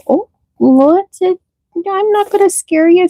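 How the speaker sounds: soothing pet talk. Real, not acted. Owner soothing her startled rabbit, high cooing voice. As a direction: reassure a jumpy bunny in a high coo.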